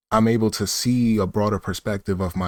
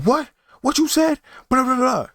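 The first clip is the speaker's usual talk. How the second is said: very high squeaky mock outrage; mock angry guy; 13 semitones higher than usual. Rude guy confronting the clerk, squeaky mock outrage. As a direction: parody his huffy comeback, way up high. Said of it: "he was like".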